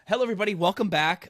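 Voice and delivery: upbeat host greeting. Acted.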